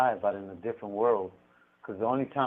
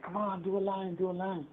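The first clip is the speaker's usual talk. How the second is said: higher, pushy peer pressure voice; coaxing cellmate; 9 semitones higher, 3 dB quieter than usual. Retold or imitated. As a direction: egg him on to do a line. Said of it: "he'd like".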